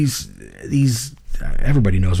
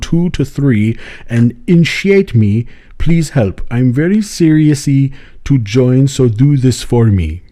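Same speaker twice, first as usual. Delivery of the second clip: put-on slow, louder pleading African accent; imitating an African accent; 3 semitones higher, 5 dB louder, slower than usual. Zambian man begging the podcaster for initiation, slow African accent. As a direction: beg slowly in broken English, African accent. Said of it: "But if I do this, then it's him".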